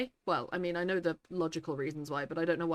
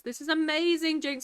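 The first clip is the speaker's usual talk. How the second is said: thrilled; 8 semitones higher than usual. Real, not acted. Reading a friend's good news, high and thrilled. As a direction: pitch jumps up with delight.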